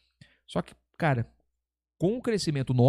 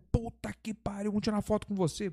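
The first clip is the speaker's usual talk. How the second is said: mock excitement, higher and hushed; imitated excited fans; 5 semitones higher, 4 dB quieter than usual. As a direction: play an amazed fan asking for a photo. Acts out fans stopping him for photo.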